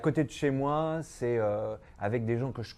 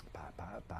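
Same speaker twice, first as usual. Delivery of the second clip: pas, pas, pas soufflé presque sans voix; chuchoté; 5 semitones lower, 15 dB quieter than usual. Real, not acted. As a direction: laisse mourir la phrase en chuchotant. Trails off admitting weak English. (murmure gêné, à peine audible)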